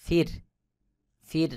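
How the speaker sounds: ফির with a thin, light র; ফির, র পাতলা করে. Shown as a demonstration. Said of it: "এটাকে পাতলা করে পড়তে হবে".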